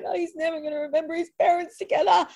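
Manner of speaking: half-hearted mock of her own tearful self; retold crying. Mother recalling her baby after the separation, a mild mock wail. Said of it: "crying and being like".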